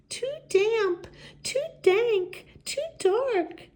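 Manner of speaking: high, squeaky little voice; squeaky mouse. Acted. Mouse complaining about the cold cave, high squeak. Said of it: "Mouse squeaks".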